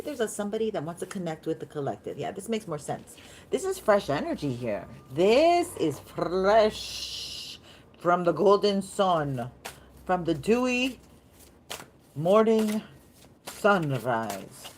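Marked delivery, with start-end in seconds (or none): 5.1-14.7 loud, slow, stretched-out declaiming; big theatrical announcement with long pauses; dramatic proclamation. Pretend. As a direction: proclaim it grandly, drawing out each phrase. Performs the phrase after saying it.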